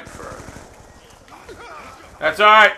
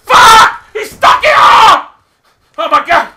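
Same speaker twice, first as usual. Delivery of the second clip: high, loud, wailing outburst; anguished scream; 9 semitones higher, 10 dB louder than usual. Real, not acted. Gamer who just got stuck, anguished scream. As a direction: scream it in real agony of frustration. Player killed by a stuck explosive.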